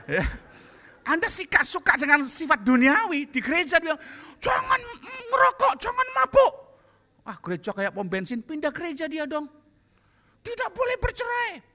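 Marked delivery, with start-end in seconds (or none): 4.4-6.5 very high, loud shouted warning; mock preacher. Preacher mocked at church. 10.5-11.7 mock preaching rule. Church rule quoted.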